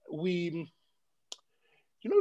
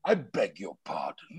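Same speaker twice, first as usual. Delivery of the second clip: much lower, softer, offended I beg your pardon; dropped voice; 13 semitones lower, 5 dB quieter than usual. Retold or imitated. Offended traveller at check-in, voice dropped low and soft. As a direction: drop the voice low as if deeply insulted. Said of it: "of course, dropped my voice".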